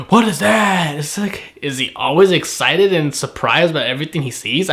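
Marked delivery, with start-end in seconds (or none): 0.1-1.0 mock gasp of surprise. Mock surprise imitating Pika's frozen face, gasp first. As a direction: gasp then a wide-eyed mock question. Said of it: "constant frozen face of".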